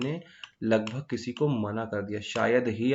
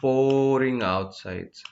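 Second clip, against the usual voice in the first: slow loud example; 2 semitones higher, 5 dB louder, much slower than usual. Shown as a demonstration. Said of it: "उसके लिए हम किसका use करेंगे".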